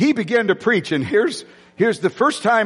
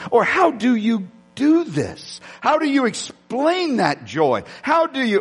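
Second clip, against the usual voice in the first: amazed asking; 7 semitones higher, slower than usual. Retold or imitated. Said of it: "people who would say to me".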